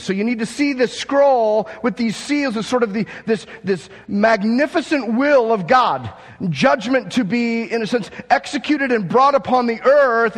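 high, loud, fervent preaching; preaching at full force. Acted. Pastor stirs the church about the scroll, full-force preaching. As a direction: preach it big to the back row.

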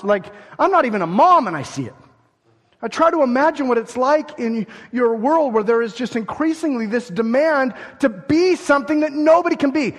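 normal talking voice

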